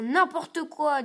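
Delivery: drawn out, defiant and slow; slow sulky retort. A narrator's reading. Simon defies his mother, slow and sulky. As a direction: drag it out to annoy mother.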